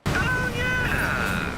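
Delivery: high, loud whoop of triumph; elated cheer. Acted. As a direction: let out a big high-pitched "yeah". Cheers after the tank mission.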